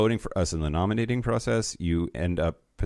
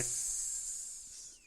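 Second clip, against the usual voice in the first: drawn-out s like a snake; 18 dB quieter than usual. Shown as a demonstration. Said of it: "can you do a snake voice".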